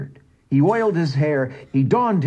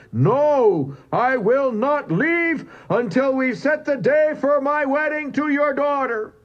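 much higher, determined roar; roaring louder. A narrator's reading. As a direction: refuse to leave, roaring it out. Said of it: "roared the louder".

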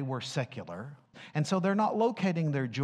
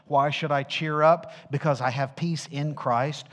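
normal talking voice

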